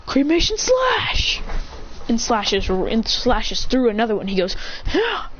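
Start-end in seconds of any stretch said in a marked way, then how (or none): 0.0-1.4 high, drawn-out move call; battle attack shout. Acted. Calls out attack name. 4.9-5.3 short high-pitched yelp; quick "ah" of someone struck, pitched up; acted death cry. Acted cry of slashed enemy.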